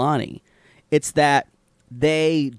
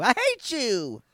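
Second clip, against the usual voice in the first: drawn-out, high mock whine; mock hatred; 8 semitones higher, 4 dB quieter, slower than usual. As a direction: whine it softly at a teasing friend. Mock anger over diet temptation.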